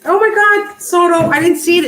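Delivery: high, loud gasp of delight; thrilled to finally see it; excited surprise. Real, not acted. Thanking her friend Soto after finding the card, excited.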